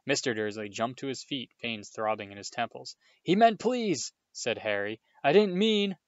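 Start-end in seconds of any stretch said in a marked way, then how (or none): 3.2-4.1 much higher, loud hurried protest; alarmed protest. Acted. 5.2-6.0 much higher, loud hurried protest; alarmed protest. Acted. Harry cut off protesting.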